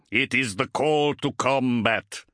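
slow, proud warrior voice; proudly. A narrator's reading. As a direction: declare it with pride. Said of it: "he told them proudly".

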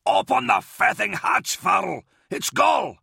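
high, exasperated shouting; urgent yell. Acted. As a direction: demand entry through a shut door. Yelling through a closed hatch.

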